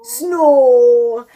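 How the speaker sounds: very loud, stretched snow with a pure "aw"; mummy's Geordie snow. Shown as a demonstration. Mum answering her daughter's tease, loud drawn-out Geordie snow. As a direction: belt out snow, holding the Geordie vowel. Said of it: "Mummy says what?".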